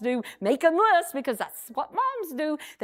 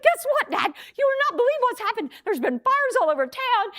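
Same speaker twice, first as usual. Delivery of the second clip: excited; 12 semitones higher than usual. Retold or imitated. Kids tell dad the news.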